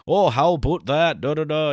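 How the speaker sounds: high mock Canadian accent, hammed up; goofy Canadian accent. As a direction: make fun of a Canadian accent. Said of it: "anytime I do a Canadian".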